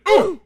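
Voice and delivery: sharp high pained grunt as he falls; a loud falling-over groan; loud high dying "ooh". A narrator's reading. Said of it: "pitched face forward with an".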